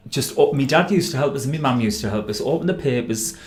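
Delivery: normal talking voice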